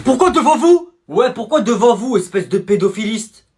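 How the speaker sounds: high, loud protest; indignant yelling. Acted. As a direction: challenge the teacher loudly. Kids protest at teacher.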